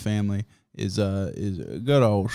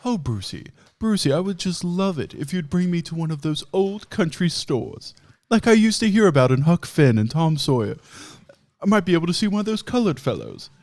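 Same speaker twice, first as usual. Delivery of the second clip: snooty grandma; 6 semitones higher, 3 dB louder than usual. Retold or imitated. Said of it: "and she goes".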